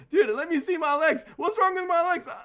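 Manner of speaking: very high, loud mock panic; hammed-up panic, stumbling and yelling; freaking out. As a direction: imitate a terrified man shrieking questions. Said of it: "And Mike just freaks out".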